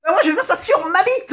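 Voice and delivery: very high comic outburst; high, mock-thrilled declaration; excited announcement. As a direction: burst out with a daft idea in a high voice. Announces absurd idea after muttered aside.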